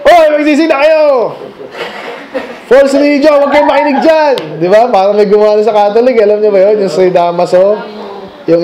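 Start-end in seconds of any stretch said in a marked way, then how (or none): 0.0-4.3 hammed-up street preacher calling out; mock street shouting. A preacher yelling at churchgoers through a megaphone, mock shout. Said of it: "Saka ako sila sigawan doon".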